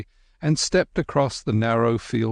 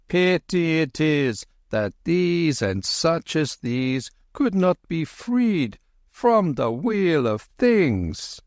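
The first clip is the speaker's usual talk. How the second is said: put-on higher, slow wistful old monk; old lama voice; 7 semitones higher, slower than usual. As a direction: muse sadly like an old monk.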